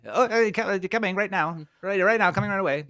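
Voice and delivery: high, loud, scrambling yes-man; flustered minion. Acted. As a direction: hurry after the boss, flustered. Hod scrambles after his boss.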